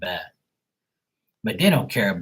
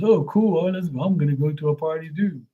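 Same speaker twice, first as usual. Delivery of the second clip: high, bright-eyed mock excitement, much higher; mock eager chat guy; 6 semitones higher than usual. Streamer mimics a clueless guy wanting to join the party, mock eager high voice. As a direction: ham up the clueless guy's eagerness.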